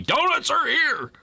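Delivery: high, drawn-out imitation of someone yelling; mock yelling. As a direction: holler it like an all-caps message, stretching the words. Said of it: "somebody was yelling at me".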